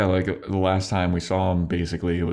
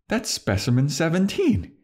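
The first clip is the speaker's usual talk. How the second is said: higher-pitched, lightly acted realization; mock shocked recognition; 10 semitones higher than usual. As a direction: imitate someone making a startling discovery. Retelling Riley's shocked recognition.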